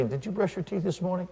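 sly little question under the breath; innocent needling. Acted. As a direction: slip it in quietly with a hidden smile. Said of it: "And I'll smile".

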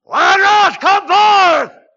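huge high-pitched shout; full-voice, drawn-out preacher's scream; loud cry. Acted. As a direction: bellow it out as a command to the dead. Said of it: "Jesus cried with a loud voice".

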